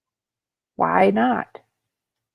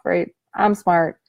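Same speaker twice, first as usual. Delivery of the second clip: ordinary talk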